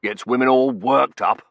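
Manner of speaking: put-on scornful old colonel; dismissive colonel. A narrator's reading. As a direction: grumble loudly in disapproval.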